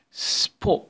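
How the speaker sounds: unvoiced whisper of the sp and p sounds. Shown as a demonstration. Teacher whispering the missing p for pupils. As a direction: breathe the p out without voice. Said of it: "أنا سامع حد بيقول ب".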